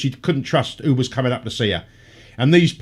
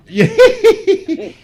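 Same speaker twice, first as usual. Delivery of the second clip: laughing yeah; 7 dB louder than usual. Real, not acted. Laughing along with covid joke.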